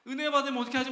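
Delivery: high, loud mock alarm; hammed-up dread. Preacher mimicking someone fretting over another's grace, hammed-up dread. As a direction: blurt it out in exaggerated worry. Acting out same spiteful worry.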